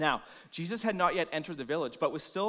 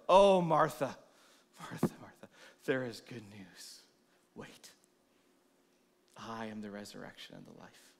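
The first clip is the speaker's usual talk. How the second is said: hushed intimate comfort, fading to a breath; tender hush; 5 semitones lower than usual. Acted. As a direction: lean in and whisper good news. Jesus gently speaks to Martha.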